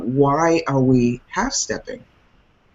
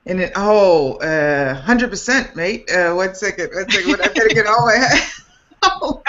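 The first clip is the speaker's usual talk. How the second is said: put-on higher-pitched, playful stab at an Afrikaans accent; Afrikaans accent attempt; 6 semitones higher than usual. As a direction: try on an Afrikaans accent, breaking into laughter. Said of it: "Can you say that in an Afrikaans accent?".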